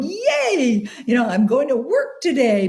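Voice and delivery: high, bright-eyed squeal of joy; a delighted little whoop, high and eager; enthusiastic cheer. Pretend. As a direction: cheer it like you can't wait for work. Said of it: "get out of bed with enthusiasm going".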